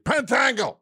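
random high-pitched shouted name; sudden yell. Real, not acted. Host randomly yelling folk people's names, sudden yell. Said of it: "Just started yelling folk people's names".

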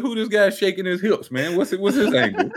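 high mock clueless voice, half laughing; Scoob. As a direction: imitate a baffled friend way up high. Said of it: "twenty minutes of Scoob said".

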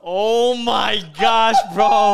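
high, loud shriek of disbelief; shocked shout. Real, not acted. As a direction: scream it in shock. Shocked at haircut reveal.

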